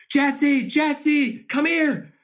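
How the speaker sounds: put-on high, eager child calling a name; a little fan shouting for attention; kid calling out. Excited kid calling from the stands.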